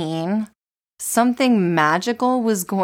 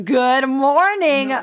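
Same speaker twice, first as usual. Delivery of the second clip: morning radio host; 6 semitones higher, 3 dB louder, much slower than usual. Retold or imitated. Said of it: "Where you're like".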